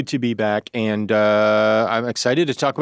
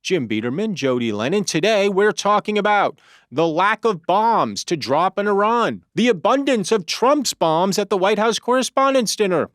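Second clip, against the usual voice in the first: higher-pitched, punchy headline read; podcast intro; 7 semitones higher than usual. Acted. Host opens the news podcast for listeners, in an announcer's read. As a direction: announce the lineup with measured emphasis.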